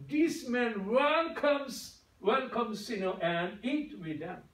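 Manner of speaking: very slow, each word stretched; slow English quote. Shown as a demonstration. Pastor quoting the English verse, very slow. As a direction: read the verse out deliberately.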